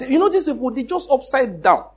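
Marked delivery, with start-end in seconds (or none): none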